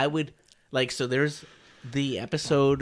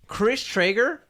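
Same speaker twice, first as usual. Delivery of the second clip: high, rising disbelief; you can't be serious; incredulous; 10 semitones higher, slower than usual. Real, not acted. Incredulous at friend's opinion.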